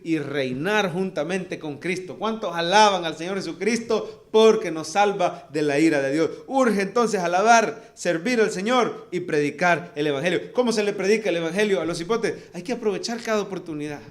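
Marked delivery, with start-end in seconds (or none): 2.2-12.0 loud, raised call to praise; rally the church with full force; rousing preaching. Acted. Preacher urging the congregation, loud and rousing.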